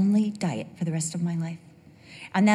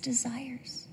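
whispered word; 4 semitones higher, 12 dB quieter than usual. Real, not acted. Sermon emphasis.

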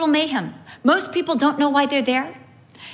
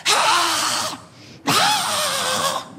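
high, shrieked "ah"; mock toddler scream; 13 semitones higher than usual. Mother imitating her child in the fit chair, screaming. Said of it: "she was just like".

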